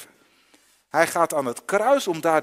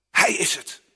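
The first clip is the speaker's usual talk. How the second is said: whispered realisation; 4 dB louder than usual. Acted. Blind man's quiet realisation.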